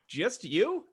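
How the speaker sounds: hammed-up rising question; bigger mock surprise. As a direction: push the incredulous question way up high.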